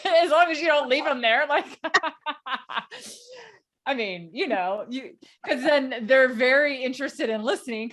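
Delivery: laughing. Real, not acted. Speaker laughing while telling a colleague about audiences. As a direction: chuckle your way through the point.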